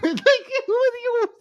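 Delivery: high, slow nerdy whine; squeaky mocking dweeb. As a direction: play the dweeb on the phone.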